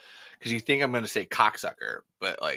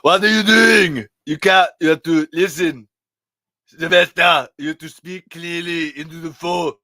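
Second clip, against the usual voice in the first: much louder, higher Arnold bark; Schwarzenegger impression; 5 semitones higher, 12 dB louder than usual. Schwarzenegger scolding Stallone on the phone, loud Arnold voice. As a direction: shout at him like Arnold Schwarzenegger. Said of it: "and Schwarzenegger".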